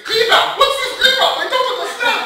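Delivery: very high, loud imitated wail; screaming. Heartbroken German man told he is on the rebound, screaming. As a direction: shriek it in hammed-up German-accented distress. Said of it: "And he screams".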